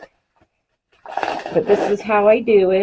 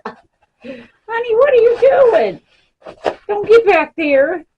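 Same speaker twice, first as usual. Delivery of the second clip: high, louder scolding of the cat; 12 semitones higher, 7 dB louder, slower than usual. Real, not acted. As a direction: stop the cat before it jumps.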